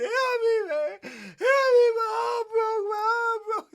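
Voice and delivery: very high, loud crying plea; hollering for help. Retold or imitated. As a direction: wail for the police like a broken man. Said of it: "hollering for the police".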